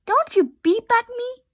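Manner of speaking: high, loud angry holler. A narrator's reading. Rabbit hollering at Robot for beeping. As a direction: snap it back furiously. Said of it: "hollered Rabbit".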